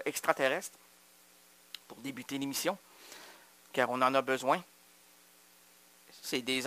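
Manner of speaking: hushed, mysterious low voice; softly ominous. Acted. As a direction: murmur it like a secret transmission. Mysterious alien message intro.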